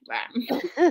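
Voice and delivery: slow laughing rawr; drawn-out giggly roar; dinosaur voice. Pretend. Playing the cute monster.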